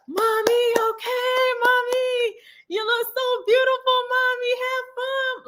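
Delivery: put-on very high, excited little-girl voice; cheering little girls. Daughters seeing dressed-up mom off, high cheering child voice. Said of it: "they my cheerleaders on the way out".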